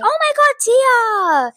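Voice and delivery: höher, mock begeistertes Oh my God; gushing kids. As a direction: spiel die aufgeregten Mitschüler nach. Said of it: "Did you not hear".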